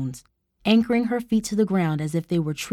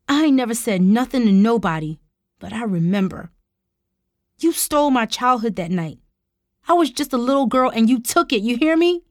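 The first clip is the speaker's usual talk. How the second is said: louder, rising hurt and anger; fuming, pained fury; 4 semitones higher, 4 dB louder than usual. A narrator's reading. Tara confronts uncle over stolen childhood, pained fury. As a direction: let fury and pain spill out, building higher. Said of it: "fury and pain spilling into her voice".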